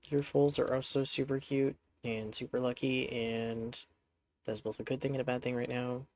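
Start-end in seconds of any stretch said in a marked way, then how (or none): none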